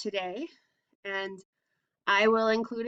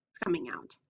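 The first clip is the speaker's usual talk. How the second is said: hushed dramatic whisper, pitch dropped low; 7 semitones lower, 8 dB quieter, faster than usual. Shown as a demonstration. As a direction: whisper it like a secret eruption. Volcano erupting, teacher dramatizes.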